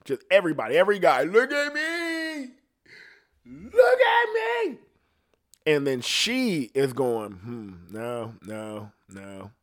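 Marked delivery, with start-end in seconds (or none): 1.2-4.8 very high, drawn-out squeal; hammed-up, slow, piercing falsetto plea; mock attention-seeking. Mocking guys begging for attention online. 7.3-9.5 low, soft string of rejections; imitating a picky, offhand refusal; dismissive browsing. Woman rejecting profiles one by one.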